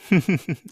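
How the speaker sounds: burst of laughter. Real, not acted. Laughing at own joke.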